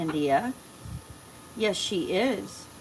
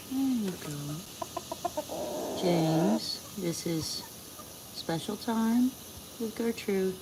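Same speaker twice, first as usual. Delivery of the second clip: ordinary talk, nothing marked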